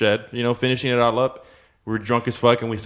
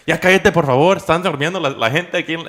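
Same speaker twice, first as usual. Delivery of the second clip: scolding grandma; 5 semitones higher, 4 dB louder than usual. Retold or imitated. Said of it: "going off on us".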